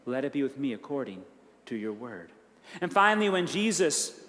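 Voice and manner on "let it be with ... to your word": much softer and lower, a near-breathless surrender; hushed prayer. Retold or imitated. Mary surrendering to God, praying softly and low. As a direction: let the prayer sink to almost nothing.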